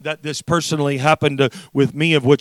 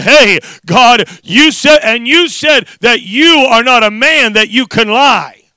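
raised, insistent appeal; bold plea to God; 10 semitones higher, 11 dB louder than usual. Acted. Preacher showing how to use the word with God, bold plea. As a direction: hold God to the word, forcefully. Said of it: "it's just saying".

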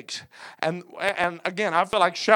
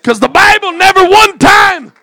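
high, loud pulpit cry; emphatic high-pitched preaching burst; preacher's shout; 11 semitones higher, 16 dB louder than usual. Real, not acted. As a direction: shout it out to the congregation.

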